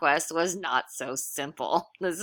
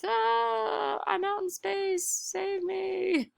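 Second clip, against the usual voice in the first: high, soft squeaky damsel voice; mock cry for help; 14 semitones higher, 4 dB quieter, slower than usual. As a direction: play a tiny helpless voice far away in space. Sue voiced in outer space.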